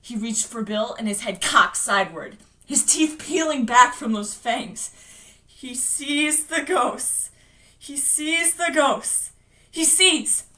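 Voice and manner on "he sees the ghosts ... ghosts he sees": slow, strained chant, slightly raised; laboured recitation. Acted. Bill fighting to finish his phrase, slow and strained. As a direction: strain for each word, drawing it out.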